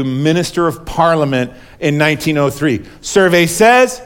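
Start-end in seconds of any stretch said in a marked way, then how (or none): 3.0-4.0 higher, drawn-out game-show catchphrase; game show host. Acted. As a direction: build suspense like a quiz host. Mimics game show host's catchphrase.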